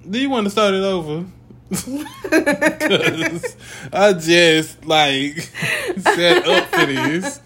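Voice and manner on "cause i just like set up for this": laughing high through the words. Real, not acted. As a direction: giggle while you talk.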